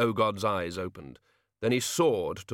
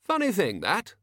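thoughtful tone; 10 semitones higher, 3 dB louder than usual. A narrator's reading. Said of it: "in the same thoughtful tone of voice".

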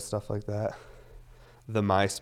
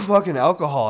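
loud outburst; 7 semitones higher, 11 dB louder than usual. Real, not acted. Coughing from alcohol fumes.

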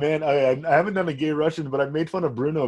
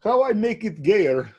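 mock Russian accent, raised pitch; 5 semitones higher than usual. Shown as a demonstration. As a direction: put on a joking Russian accent. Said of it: "talk in Russian accent".

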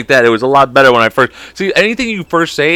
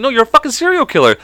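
high, raised accusing retort; accusing guy; 11 semitones higher than usual. Acted. Victim accusing the so-called superhero, high-pitched retort. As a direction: fire back in comic outrage. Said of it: "He's like".

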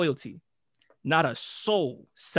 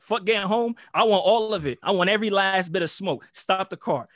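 louder, higher angry shout; retold furious demand; 4 semitones higher, 6 dB louder than usual. Friend in the car demanding they stop to fight. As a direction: bark it like a hothead itching for a fight. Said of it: "He's like".

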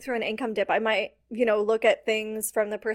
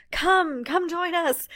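mock urgent pitch, pitched higher; pushy sales invite; 5 semitones higher, slower than usual. Coach mocking old pushy marketing, high eager urgent call. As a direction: oversell it like a desperate sales push. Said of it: "it went from urgency and scarcity".

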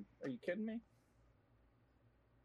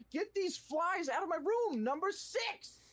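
exasperated yell; 14 semitones higher, 3 dB louder, slower than usual. Real, not acted. Shouting at flies.

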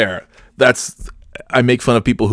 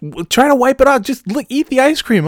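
very high, loud retold order; shrieky mock scolding; exasperated yelling; 4 dB louder than usual. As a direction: yell instructions in exasperation. Retelling Kim yelling back.

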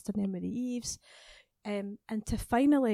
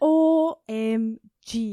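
loud, very slow, letter by letter; drawn-out amazement; 8 dB louder, much slower than usual. Retold or imitated. Singer relives the radio news, amazed and drawn-out. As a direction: stretch each letter out loudly.

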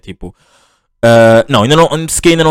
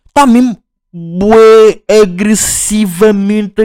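high, very slow stretched words; drawn-out emphasis; 7 semitones higher, much slower than usual. Real, not acted. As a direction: stretch every word for emphasis. Stressing aggression. (ênfase lenta e aguda)

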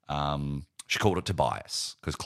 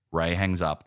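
ordinary talk

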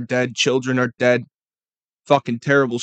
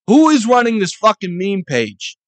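louder, higher, stress on fucking; angrier repeat; 7 semitones higher, 7 dB louder than usual. Real, not acted. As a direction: hammer the question home harder. Repeats question harder.

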